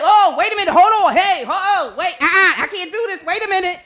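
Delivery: high, hurried, squealing protests; mock panic. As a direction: plead to stop like you're about to tip over. Panicking in wobbling kayak.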